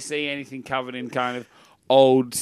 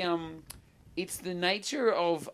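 ordinary delivery

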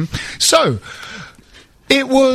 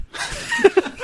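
laugh; 6 dB quieter than usual. Real, not acted. Breaks up mid-impression.

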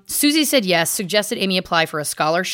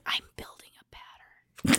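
whispered aside, much softer, just breath; joking whisper; 11 dB quieter than usual. Real, not acted. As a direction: lean in and breathe the words as a secret. Joking aside between podcast hosts.

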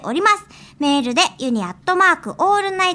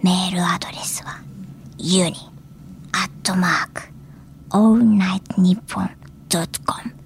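whispering; 7 semitones lower, 6 dB quieter than usual. Shown as a demonstration. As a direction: breathe the words softly into the mic. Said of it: "番組情報をささやき声でお知らせするパターンです".

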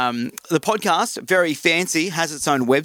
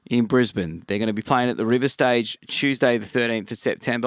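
ordinary talk, nothing marked

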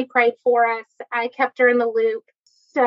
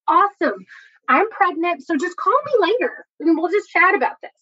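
higher-pitched, falsely casual announcement; mock breezy; 6 semitones higher than usual. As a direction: drop the big news as if it were nothing. Acts out blurting news breezily to husband.

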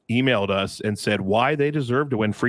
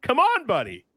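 mock exasperated; 12 semitones higher than usual. Real, not acted. Teasing winner without last name.

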